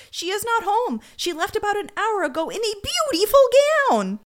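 high, eager doorman voice; gushing doorman. Retold or imitated. Doorman shown a photo, high eager gushing. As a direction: chirp it like a fan.

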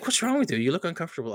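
imitating the VP's puzzled concern; puzzled boss. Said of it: "and he's like".

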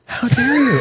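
high, hammed-up offended gasp; mock indignant, high and theatrical; mock outrage. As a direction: play the scandalised diva. Mock offended at being accused.

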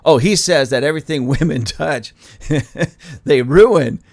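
laughing through a mock quote, ending loud on ruin; mock the accuser while chuckling; mocking quote with laughter. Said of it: "and somebody says".

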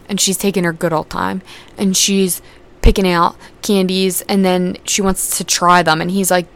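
ordinary talk, nothing marked

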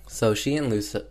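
ordinary talk

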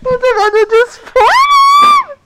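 high, slow scream. Real, not acted. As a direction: shriek it out in disgust. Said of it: "why did y'all scream at the same time?".

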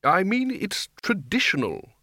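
mildly snappish, stretching the key word; snapping. A narrator's reading. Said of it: "the Arch-Chancellor snapped".

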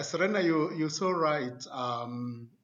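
ordinary talk, nothing marked

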